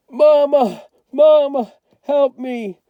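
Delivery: high, loud panicked cries; screaming for help. Acted. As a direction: yell for Mama while gasping out of the water. Drowning boy calls mother.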